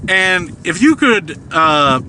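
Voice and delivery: ordinary talk, nothing marked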